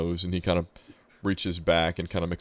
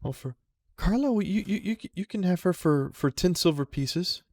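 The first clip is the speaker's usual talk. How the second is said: put-on high, halting boy's voice; nervous boy; 11 semitones higher than usual. Boy selling the raccoon to the player, high nervous stammer. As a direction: stammer it out like a shy kid.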